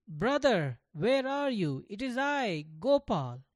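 put-on a boy calling for his brother in the forest. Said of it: "Gopal called out again".

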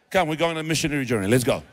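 brisk order. Retold or imitated. Paul ordering Silas off on the missionary journey, fast and loud. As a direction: rush him out the door. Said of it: "He says".

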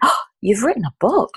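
mock astonished rise on book; flabbergasted surprise. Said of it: "she was flabbergasted".

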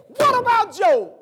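high, loud ringing challenge; God's challenge. Acted. God boasting to the adversary, a loud high challenge. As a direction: call it out like a proud boast. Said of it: "and said".

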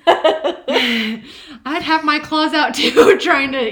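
slow, loud, laughing. Real, not acted. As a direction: laugh through the words. Laughing about cat's claws.

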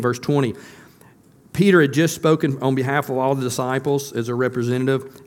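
ordinary delivery

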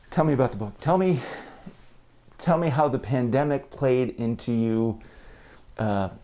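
muffled through a face mask; speaking through a mask. Real, not acted. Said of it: "if I sound a bit muffled in this episode".

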